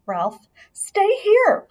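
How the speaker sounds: high, slow, drawn-out plea; pleading. A narrator's reading. Mother begging Ralph to stay, slow and high. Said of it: "pleaded his mother".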